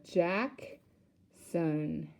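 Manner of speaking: "Jackson" slowly, a pause between the two parts; split into syllables. Shown as a demonstration. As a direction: break "Jackson" into two separate beats. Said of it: "We know that's two syllables".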